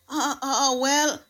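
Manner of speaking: faint-hearted stammer, lightly acted; muttering hesitation. A narrator's reading. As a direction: stall helplessly on repeated uhs. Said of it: "muttered the hen, almost fainting".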